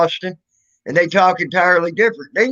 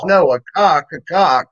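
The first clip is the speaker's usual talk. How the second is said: put-on Boston accent; 3 dB louder than usual. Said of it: "their own little dialect of speaking".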